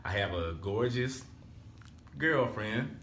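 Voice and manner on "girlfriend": ordinary delivery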